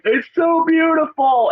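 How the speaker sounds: high, slow, breathless wonder; awed and overwhelmed. Retold or imitated. Standing in the road, overcome with awe at the beauty. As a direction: marvel at it, half crying and half laughing. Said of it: "crying, smiling, and laughing".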